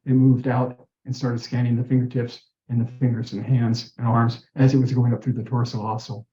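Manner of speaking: ordinary talk, nothing marked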